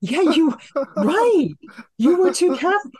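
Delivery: high-pitched, giggly exclamations of relief; laughing mock gratitude. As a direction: squeal it through laughter, thanking mom in retelling. Laughing retelling of her reply to mom.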